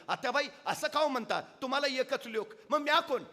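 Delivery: high, loud vow to fight; young widow vowing to fight for swaraj; defiant widow. Acted. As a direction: step forward and swear to fight. सरकन पुढं झाली.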